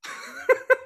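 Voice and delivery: high laugh; real amused laugh; laughing at the memory; burst of laughter. Real, not acted. Laughing at the scene.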